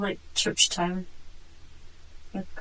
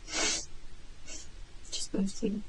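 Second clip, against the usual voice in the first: barely audible; 6 dB quieter, much slower than usual. Real, not acted. Suspect answers about diagnosis.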